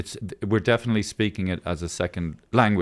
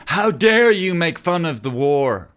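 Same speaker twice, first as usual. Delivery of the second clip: mock outrage; 8 semitones higher, 5 dB louder, slower than usual. Mock outrage at joking about war.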